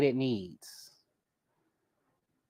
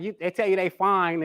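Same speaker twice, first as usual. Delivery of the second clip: ordinary talk, nothing marked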